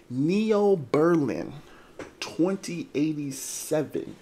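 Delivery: high, loud, stretched title announcement; announcer intro. Pretend.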